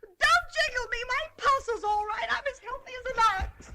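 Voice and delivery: loud, high, indignant protest; screwball comedy banter. Acted. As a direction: snap back at the doctor. Patient snaps at doctor.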